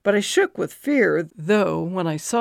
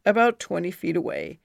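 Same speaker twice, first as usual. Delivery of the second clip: usual speaking voice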